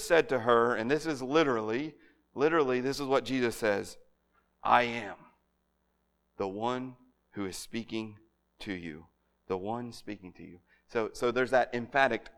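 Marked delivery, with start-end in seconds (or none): 4.6-10.6 hushed reverent quote. Shown as a demonstration. Quoting Jesus to the woman.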